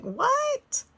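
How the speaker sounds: high, loud, drawn-out "what?"; incredulous retold reaction. Wife reacting to husband liking her talk, incredulous.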